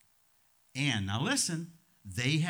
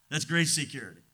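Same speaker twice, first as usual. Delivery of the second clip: ordinary talk, nothing marked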